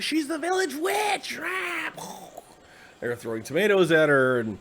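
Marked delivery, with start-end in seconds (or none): 0.0-1.9 very high, drawn-out strangled cry; mock mob accuser. Villagers pelting a woman as a witch, high mock shriek. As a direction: shriek the accusation slowly, way up high, without real volume.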